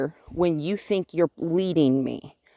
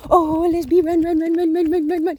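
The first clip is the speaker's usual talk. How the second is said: running; 10 semitones higher, 5 dB louder than usual. Real, not acted. Running with dog, high excited.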